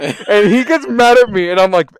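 loud, high, laughing through the words; can barely get it out for laughing; laughing while telling. Real, not acted. Telling the podcast host about the heal prank, laughing loudly.

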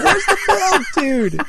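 mock outcry. Host imitates friends misted with milk, high mock outcry. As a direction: imitate friends squealing in dismay. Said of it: "you guys are like".

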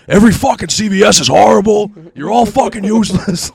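loud, high retold tirade; hammed-up furious shouting; angry rant. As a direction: blast the whole staff. Retold rant at pharmacy staff.

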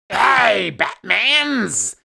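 loud, hammed-up villain greeting; a cartoon Joker shouting across the room. Playing Joker to his friend, loud hammy villain voice. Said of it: "I bet I'll be Joker now".

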